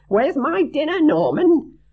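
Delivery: high, loud shrill joke voice; Chrissy imagined yelling a prank line; mock screeching prankster. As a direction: grab and yell the punchline.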